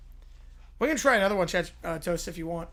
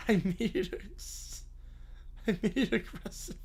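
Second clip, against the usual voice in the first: wheezing, nearly voiceless laughter; laughing; 9 dB quieter than usual. Real, not acted. Streamer admitting an accidental aggressive prompt, wheezing with laughter. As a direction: squeeze the words out through helpless laughter. Breaking down laughing at own mistake.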